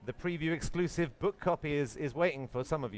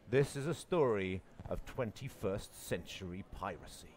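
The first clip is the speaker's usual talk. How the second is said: movie trailer voice. Shown as a demonstration. Host pitching his pirate book as a film, movie trailer voice. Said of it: "authentic movie trailer voice".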